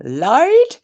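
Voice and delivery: loud, high call across the house in a put-on accent; shouting for Lloyd. Imitating wife calling husband Lloyd from another room, loud high yell. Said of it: "she shouts him like that".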